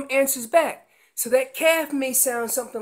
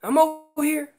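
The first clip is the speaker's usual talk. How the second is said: higher, reassuring call back; mother answering; 5 semitones higher than usual. Acted. Mom answering her calf, raised reassuring voice. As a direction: call back that all is fine, pitched up. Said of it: "And she says".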